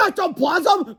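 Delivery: bully spooked; frightened cry. Acted. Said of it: "cried Bug".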